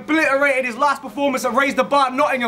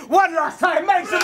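hype shout; 5 semitones higher than usual. Real, not acted. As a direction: call out to the crowd for noise. Host hyping the crowd.

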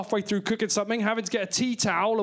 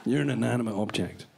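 very low, muted voice; low, halting and hushed; hushed mock confrontation; 11 semitones lower, 4 dB quieter than usual. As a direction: drop low and half-whisper, in mock seriousness.

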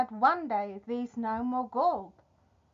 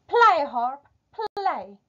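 raised, ringing order, easing off; commanding giant; 4 semitones higher, 6 dB louder, slower than usual. Acted. Giant orders his magic harp to play, commanding. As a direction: command the harp with a high push.

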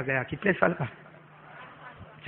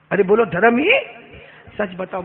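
ordinary talk

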